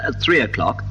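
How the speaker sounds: ordinary talk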